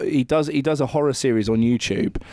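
ordinary delivery

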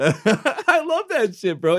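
laughing high through the words. Real, not acted. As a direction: giggle it out, delighted. Laughing while gushing over theme.

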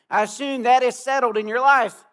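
put-on a minister testing a young man, pointed; pointed question. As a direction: push him to admit it. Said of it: "the minister said to the young man".

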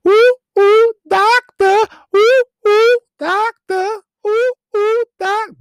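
very high, loud hooting chant of "doctor"; crowd hooting. Retold or imitated. Crowd at the players' ball hooting for the doctor, high and loud. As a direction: hoot like a rowdy crowd cheering. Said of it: "and you can hear".

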